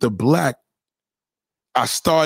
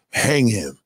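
ordinary talk, nothing marked

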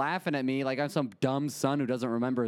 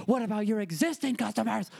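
heated complaint; 8 semitones higher than usual. Retold or imitated. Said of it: "get heated".

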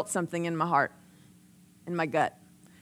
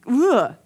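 loud, raised groan of frustration; frustrated ugh; 3 semitones higher, 7 dB louder than usual. Retold or imitated. Preacher groans over giving up a TV show, frustrated. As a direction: let out an exasperated "ugh". Acted frustration at a hard choice.

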